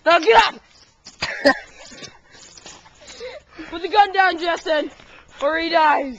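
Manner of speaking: loud commanding bark; shouted orders. Pretend. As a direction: yell orders like a hostage-taker. Playing hostage-taker shouting orders.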